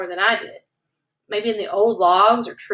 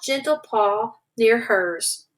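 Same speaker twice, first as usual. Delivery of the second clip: ordinary delivery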